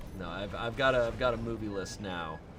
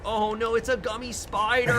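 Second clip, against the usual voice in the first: mock surprise; 9 semitones higher, 4 dB louder than usual. Reenacting the award speech.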